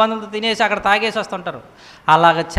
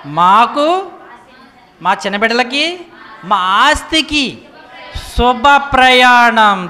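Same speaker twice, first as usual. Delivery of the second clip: slow, weighty reading of scripture, louder; 2 semitones higher, 4 dB louder, much slower than usual. Acted. Preacher reading Ezra to his congregation, slow and solemn. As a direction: read each phrase slowly like a sacred text.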